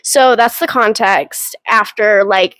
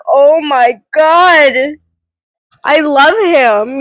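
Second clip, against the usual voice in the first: imitating her own swoon; much slower than usual. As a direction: swoon over a fictional crush. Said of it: "I'm like".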